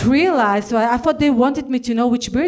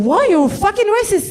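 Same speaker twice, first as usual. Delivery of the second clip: mock outrage; 8 semitones higher than usual. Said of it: "I said".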